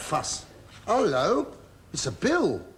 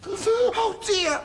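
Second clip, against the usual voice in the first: mock lament; 13 semitones higher than usual.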